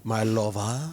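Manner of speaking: "My lover" in a hammed-up French accent, said as "my lov-ah". Shown as a demonstration. Joking with her co-host, purring "My lover" in mock French. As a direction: go full cartoon French skunk on "My lover". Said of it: "I'm trying to get my Pepe Le Pew going".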